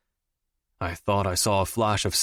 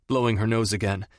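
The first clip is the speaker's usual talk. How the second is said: plain reading voice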